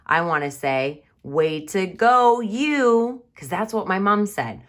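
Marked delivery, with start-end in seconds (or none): 1.2-3.3 loud, high, slow praise; big proud cheer; strong feeling. Shown as a demonstration. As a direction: cheer the child on. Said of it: "She said it with a strong feeling".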